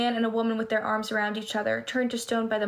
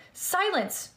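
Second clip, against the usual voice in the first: a touch of menace, higher; mild snarl; 7 semitones higher than usual. Acted. Medusa silencing Annabeth, in a mild snarl. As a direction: snap it out as a hissing monster. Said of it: "Medusa snarled".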